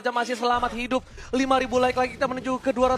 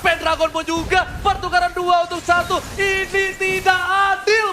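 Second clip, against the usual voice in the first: excited shout; 5 semitones higher than usual. Real, not acted. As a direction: shout it out as the fight swings. Caster reacts to team fight.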